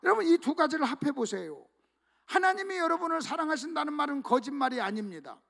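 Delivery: ordinary talk, nothing marked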